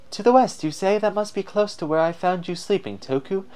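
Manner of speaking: high-pitched, perky spirit voice; wind spirit. Acted. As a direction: chatter to a companion in a high spirit voice.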